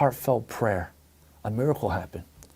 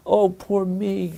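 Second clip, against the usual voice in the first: high, slow self-pitying whine in parody; mock kvetching; 8 semitones higher, much slower than usual. Preacher mocking people who kvetch, self-pitying. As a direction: drag it out like a hammed-up schlemiel. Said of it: "people who kvetch, people who act like a schlemiel".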